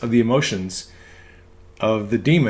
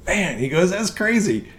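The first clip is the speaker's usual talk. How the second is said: amazed patient; 7 semitones higher than usual. Retold or imitated.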